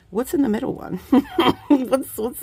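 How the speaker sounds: giggling through the question; real giggle, words half swallowed; laughing. Real, not acted. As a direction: laugh while you ask. Laughing at the mystery box.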